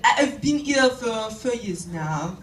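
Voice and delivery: put-on slow, caricatured French accent. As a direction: mimic a Frenchman's English, drawn out. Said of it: "That's how it sounded anyway".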